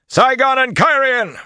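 loud, high-pitched war cry. A narrator's reading. Bertholm spurring into the charge, shouting. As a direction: bellow it out to rally riders. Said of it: "Bertholm raised his own voice".

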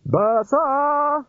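high shouted call across the beach; yelling. Acted. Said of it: "Red Cully yelled".

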